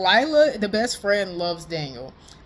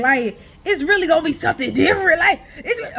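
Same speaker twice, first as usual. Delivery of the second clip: excited; 7 semitones higher, 5 dB louder than usual. Real, not acted. Excited about the outfit.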